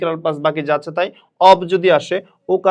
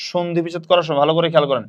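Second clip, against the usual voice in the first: normal talking voice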